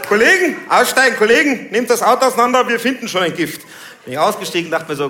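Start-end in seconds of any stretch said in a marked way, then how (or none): 0.1-3.6 high, loud barked orders; offended policeman rallying the squad; calling colleagues. Acted. Cop ordering colleagues to search the car, loud shouted orders.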